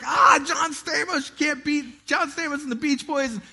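high, slow mock-excited cheer about John Stamos; excited fan. Preacher recalling cheering at the concert, high excited gush. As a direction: gush like a starstruck fan at the show.

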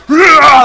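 loud, high mock roar; hammed-up outburst of anger; angry roar. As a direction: explode in a pretend rage. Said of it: "when you respond with anger".

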